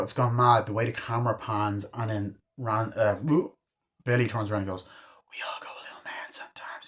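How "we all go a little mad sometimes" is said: hushed killer's whisper; sinister whisper. Retold or imitated. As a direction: whisper it as the film villain. Film killer's sinister line quoted.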